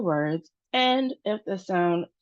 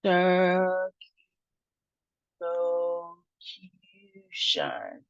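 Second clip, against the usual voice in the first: "Circumlocution" extremely slow, broken into syllables; slow sounding-out; much slower than usual. Shown as a demonstration. Teacher leading learners through a hard word, slow sounding-out. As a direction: pull "Circumlocution" apart with long held syllables and gaps. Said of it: "So let's try it".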